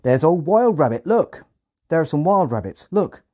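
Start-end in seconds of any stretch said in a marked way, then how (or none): 0.0-1.4 high, loud Hampshire farmer shout; loud rural Hampshire accent. Retold or imitated. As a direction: call out like an excited Hampshire farmhand. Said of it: "in a thick Hampshire accent".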